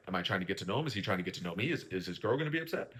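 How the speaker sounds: soft, low mock worried questions; serious guy's worries. Podcaster imitating a serious guy's anxious thoughts, soft and low. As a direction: mutter the worries to yourself.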